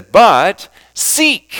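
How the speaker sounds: "seek" punched high and loud; strong emphasis on the word "seek"; stress on seek. Shown as a demonstration. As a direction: hit "seek" hard after a short "But". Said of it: "emphasizing each word".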